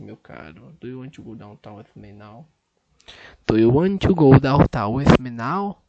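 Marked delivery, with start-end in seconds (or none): 3.5-5.8 loud, deliberate speech into the mic; second try so the app accepts it, spoken loud; loud careful repeat. Shown as a demonstration. Loud, clear repeat for the machine.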